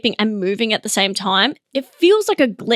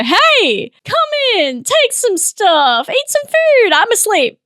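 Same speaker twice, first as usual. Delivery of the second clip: lilting, higher mock welcome with long vowels; sleepwalker greeting intruders; 6 semitones higher, slower than usual. Comic sleepwalker welcoming burglars in, mock-friendly. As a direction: invite them in like a cheery sleepwalker. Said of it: "just close my eyes and go".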